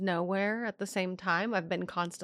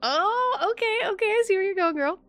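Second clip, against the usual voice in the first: high, loud squeal of approval; high-pitched "I see you" hype; knowing, teasing excitement; 12 semitones higher, 4 dB louder than usual. Real, not acted. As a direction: cheer her on in a high voice. Teasing reaction to the story.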